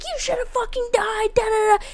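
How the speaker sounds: high-pitched imitation of an angry yell; hammed-up furious screamer, held high; mock screaming. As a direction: rant high and strained, without full volume. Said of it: "he's fucking screaming".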